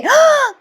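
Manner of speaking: sharp high hammed-up gasp; mock gasp. Husband hearing Priya cooked everything, hammed-up gasp. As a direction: clutch your chest and gasp for comic effect. [name]'s mock chest pain.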